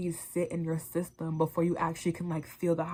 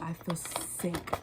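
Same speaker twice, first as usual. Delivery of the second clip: sick moan; 5 dB quieter, much slower than usual. Retold or imitated. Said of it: "I'm so fucking high".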